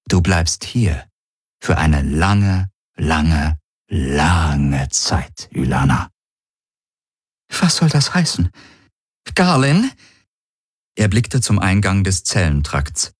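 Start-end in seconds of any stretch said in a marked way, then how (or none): 0.1-6.1 slow, sinking in pitch toward the name; genüssliche Drohung. Acted. Galen kostet die Strafe aus. 7.6-9.9 high-pitched, alarmed prisoner; alarmed question. Acted. Gefangene fragt erschrocken nach.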